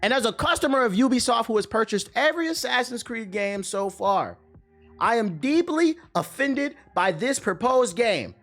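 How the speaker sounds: high, pompous, self-righteous read; mock outraged commenter.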